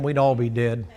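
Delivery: usual speaking voice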